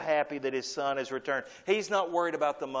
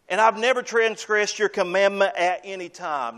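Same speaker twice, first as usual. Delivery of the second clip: boastful brother; 4 semitones higher, 5 dB louder than usual. Retold or imitated. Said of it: "it's the boast he makes".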